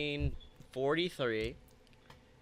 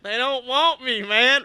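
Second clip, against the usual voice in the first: put-on shouted, higher whine of an abandoned kid; mock baby outburst; 8 semitones higher, 9 dB louder than usual. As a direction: yell it like a sulky castaway. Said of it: "He was like".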